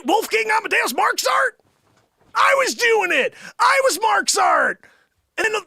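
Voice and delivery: high, loud hammed-up outrage; mock-furious boastful shouting; indignant shout. Indignantly claims to be Mozart.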